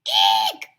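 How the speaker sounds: put-on shrill, loud girl's shriek; scream. Emily screaming at the reveal. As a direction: let out a high, piercing eek. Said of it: "screamed Emily".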